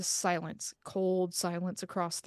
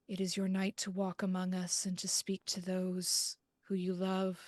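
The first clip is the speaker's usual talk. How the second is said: medium's invocation; 5 dB quieter than usual. Acted. Medium channeling spirits at seance.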